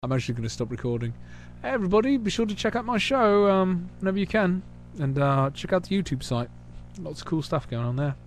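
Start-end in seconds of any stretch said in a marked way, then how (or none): 1.6-8.2 high, upbeat sign-off; announcer voice. Acted. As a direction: pitch it like a show host. Signing off to viewers.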